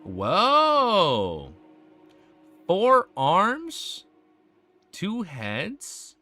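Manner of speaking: drawn-out, swooping disbelief; real astonishment. Real, not acted. Streamer sees Sunny's monster form, astonished. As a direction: let each question climb in amazement, with pauses between. First sight of monster artwork.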